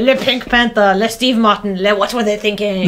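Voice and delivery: hammed-up fake French accent; abysmal French accent. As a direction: mangle it like a phony Frenchman. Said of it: "French accent that is truly abysmal".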